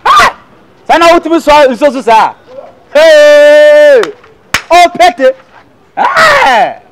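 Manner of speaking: high, loud cries of disbelief; shocked outburst. Acted. Reacting to Opete, shocked high outburst. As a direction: exclaim in shock, voice leaping high.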